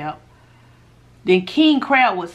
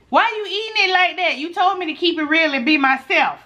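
much higher, incredulous mock complaint; imitating critics; 8 semitones higher than usual. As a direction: throw their nagging back at them. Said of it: "they say".

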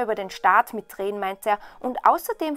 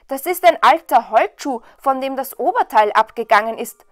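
loud, raised, derisive voice; scornful brothers; 2 semitones higher, 10 dB louder than usual. A narrator's reading. Brothers ask if the princess gets it, scornfully. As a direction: deride his find loudly. Brothers deride the old clog.

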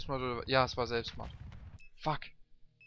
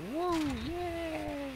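excited whoop. Real, not acted. Excited jump in game.

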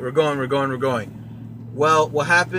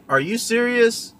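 much higher, rising on serious, in mock surprise; surprised; 7 semitones higher than usual. Girlfriend reacts to the proposal, imitated surprised with a high pitch. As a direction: imitate her delighted disbelief. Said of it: "she's saying it like she's surprised".

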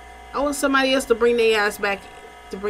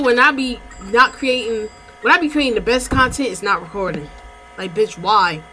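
usual speaking voice

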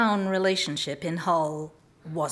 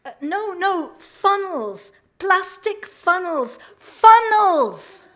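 builds from high to a loud, higher shout on funnels; getting louder; 10 semitones higher, 7 dB louder, slower than usual. Retold or imitated. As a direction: shout it louder each time, as if volume helps. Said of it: "getting louder and louder".

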